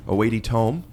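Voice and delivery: "A weighty tome" rising like a question; questioning inflection. Real, not acted. Guest echoing host's phrase, unsure rising question on "tome". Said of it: "you said it like you didn't know".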